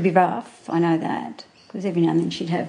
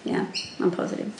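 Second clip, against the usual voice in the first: breathy near-whisper, softer; quiet repeat; 5 dB quieter, faster than usual. Real, not acted. To the interviewer, a soft whispered echo. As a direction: say it again under her breath.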